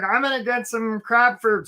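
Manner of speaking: put-on cartoon character voice; Charlie the crab. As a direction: play an eager silly crab. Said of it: "So Charlie's like".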